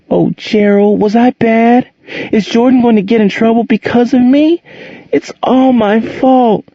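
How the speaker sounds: put-on a scared child blaming herself, close to panic; almost hysterical. A narrator's reading. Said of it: "Angel was almost hysterical".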